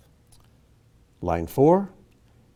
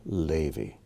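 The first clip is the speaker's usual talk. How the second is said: Levi accented on LE, lower and softer; first-syllable stress; 5 semitones lower, 7 dB quieter than usual. Shown as a demonstration. Teacher confirming the accent, Levi stressed on LE. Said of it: "accent on the first syllable".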